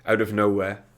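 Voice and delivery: ordinary talk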